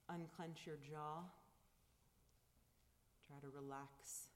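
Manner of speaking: calm, unhurried guiding of the breath; guided meditation. Acted. Leads a silent meditation.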